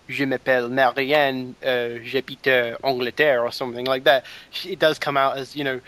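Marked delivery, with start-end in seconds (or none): 0.1-3.4 proper French pronunciation; French accent. Shown as a demonstration. Showing how French should sound, French accent. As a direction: say it with a native-style French accent. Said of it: "instead of".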